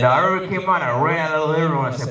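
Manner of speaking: ordinary talk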